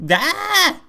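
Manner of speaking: sudden high-pitched held squeal; high yelp. Acted. Player reacting to Princess Peach's fate, high mock yelp. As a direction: let out a mock high scream.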